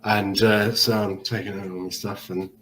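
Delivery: ordinary talk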